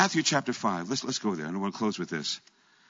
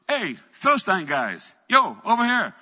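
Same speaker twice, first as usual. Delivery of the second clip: calling out; 8 semitones higher, 5 dB louder than usual. Retold or imitated. Jonathan calls out to the Philistine soldiers, loud hail. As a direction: call up high and loud to the enemy soldiers. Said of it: "he yells out to the garrison".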